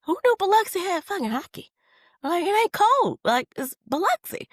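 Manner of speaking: high, loud disbelief; incredulous. Real, not acted. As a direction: exclaim in total disbelief. Incredulous about hockey in Biloxi.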